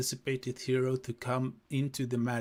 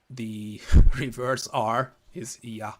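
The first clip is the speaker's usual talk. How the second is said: laughing; 7 dB louder, slower than usual. Real, not acted. Laughs explaining Cyrillic letter.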